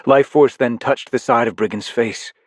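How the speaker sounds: usual speaking voice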